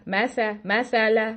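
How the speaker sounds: "मै सा" said with "ऐ" from spread lips; a demonstration of the mistake, lips stretched; wrong spread fatha. Shown as a demonstration. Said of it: "बा की बजाय वो बै बन जाती है". (गलत फतहा "मै सा")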